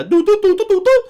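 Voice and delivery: high, loud "doo doo" phone beeps; imitated dial tones. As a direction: mimic touch-tone beeps high in the voice.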